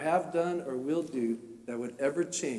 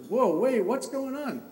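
startled wife; 8 semitones higher than usual. Retold or imitated. Wife's startled question, retold.

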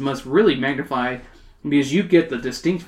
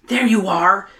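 raised, relieved greeting; 5 semitones higher, 4 dB louder than usual. Acted. Emily finding the fly after class, relieved. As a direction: pitch it up with relief. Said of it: "with relief".

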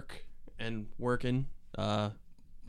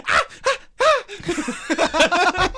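high moans; 8 dB louder, much slower than usual. Retold or imitated. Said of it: "He's like".

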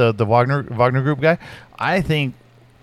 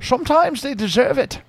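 much higher Scottish Connery voice; high, pinched Scottish-accented Connery; Connery impression; 10 semitones higher, 4 dB quieter than usual. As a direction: pitch the burr up high. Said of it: "he's like".

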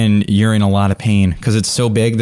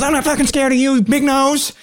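very high, defiant yell; high squeaky tough guy; NPC yelling. Acted. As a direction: scream it in his face. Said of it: "He goes".

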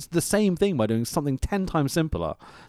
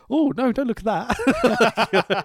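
imitating sister; 9 semitones higher, much slower than usual. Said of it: "I remember my sister going".

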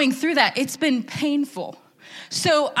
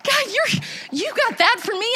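high, amazed exclamation to God; excited awe; 10 semitones higher, slower than usual. Retold or imitated. Preacher recalling her prayer to God, excited awe. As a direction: marvel at God in a high, lifted voice. Awed talk to God about glimpses.

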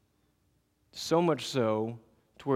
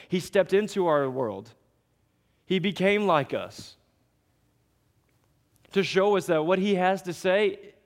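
higher, louder fervent sermon; preaching at full force; 4 semitones higher, 3 dB louder than usual. Acted. Pastor driving home sin and grace: fervent preaching. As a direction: preach it hard to the congregation.

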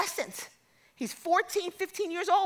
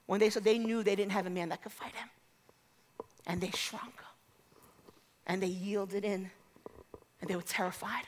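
low, very soft storytelling; subdued, low and quiet; hushed narration; 5 semitones lower, 8 dB quieter than usual. A narrator's reading. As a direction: drop the voice right down as they shrink. Hushed telling of Israel shrinking.

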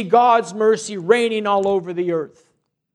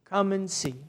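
"Come and see." said softer, falling away; soft repeat; 7 dB quieter than usual. Retold or imitated. Bishop repeating his invitation to the visitor, softly. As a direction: let the repeat drop to a soft, gentle close. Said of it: "He just said".

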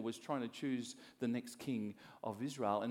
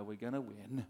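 low, subdued team reply; half-hearted, underpowered cheer; mock unexcited team; 4 semitones lower, 4 dB quieter than usual. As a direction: answer the coach with no excitement at all. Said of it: "Really excited".

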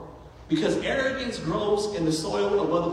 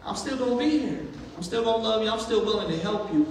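voicing God; 6 semitones higher than usual. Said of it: "God's like".

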